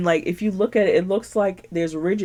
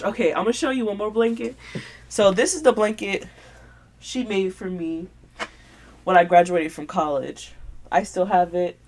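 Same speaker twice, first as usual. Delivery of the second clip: normal talking voice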